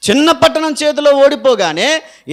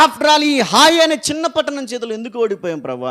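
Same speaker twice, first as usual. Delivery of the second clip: crying out loud; 5 semitones higher than usual. Acted. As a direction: tear the voice open in grief. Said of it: "గొంతు చించుకొని ఏడుస్తున్నాడు".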